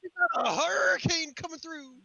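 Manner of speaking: high, mock old sea-dog voice; teasing mock seaman; sailor voice. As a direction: sound like someone from Moby Dick. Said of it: "You sound like somebody from Moby Dick".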